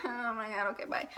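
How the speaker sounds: breathy near-whisper, trailing off; whispered goodbye. Retold or imitated. Shy girl whispering bye to classmates. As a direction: mutter it under your breath, mortified.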